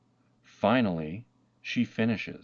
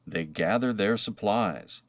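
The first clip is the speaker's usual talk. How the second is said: usual speaking voice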